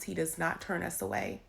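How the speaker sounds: normal talking voice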